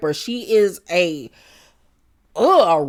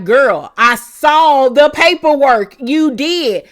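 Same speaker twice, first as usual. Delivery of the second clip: louder, slow, pointed confrontation; confronting; 6 semitones higher, 7 dB louder, slower than usual. Retold or imitated. Jen calling out Tamra, loud and deliberate. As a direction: lay it out slowly and firmly.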